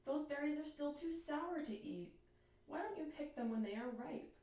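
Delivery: mildly scolding little bird; a gentle wrinkled-nose telling-off; making a face. Acted. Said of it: "making a face".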